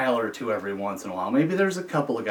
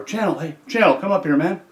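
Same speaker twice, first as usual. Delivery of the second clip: raised-voice holler, higher pitched; calling out; 6 semitones higher, 4 dB louder than usual. Retold or imitated. Officer hailing inmate from the tower, calling out. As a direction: call someone over from up high.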